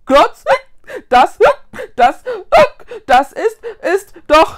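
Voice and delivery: loud, high stammer broken by hiccups; panicked hiccuping. A narrator's reading. Hiccuping ghost realises where they are, panicked. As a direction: hiccup sharply after each word. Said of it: "bekam vor lauter Verwirrung einen Schluck auf".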